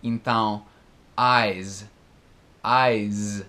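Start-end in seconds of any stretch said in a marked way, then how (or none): 1.2-3.4 eyes said with a buzzing Z at the end; eyes ending on a voiced Z, like "aiz"; final Z sound. Shown as a demonstration. Teacher contrasting eyes with ice, eyes with a buzzing Z. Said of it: "é como se fosse um Z".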